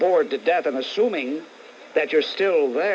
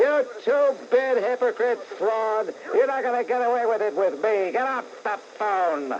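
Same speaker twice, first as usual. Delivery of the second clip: contemptuous outburst; 7 semitones higher than usual. Real, not acted. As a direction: cut the hypocrite down, word by word. Host berates caller on air.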